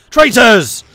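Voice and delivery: loud, high cry of accusation; dramatic shout. Acted. Game player proclaiming an event headline, dramatic shout. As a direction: shout it like a denouncement.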